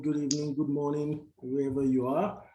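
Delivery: ordinary delivery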